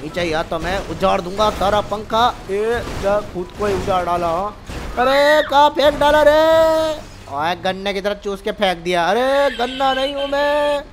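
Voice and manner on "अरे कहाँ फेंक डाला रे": high, loud panicked yell while flung away; alarmed yell. Acted. Thrown away by the fan.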